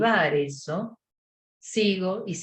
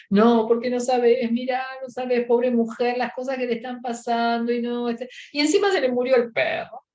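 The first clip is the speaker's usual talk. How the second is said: high, teary wailing voice of someone sobbing at a movie; imitating crying; 6 semitones higher than usual. Friend crying on the couch over a sad movie, high teary wail. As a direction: play the friend choking up over the film. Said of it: "te encuentro sentado en el living, llorando".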